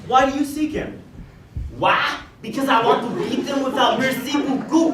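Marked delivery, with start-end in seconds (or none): 2.4-4.9 hammed-up French accent with a laugh. Frenchman threatening Gerard, in a hammed-up French accent. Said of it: "I believe he's a French intellectual".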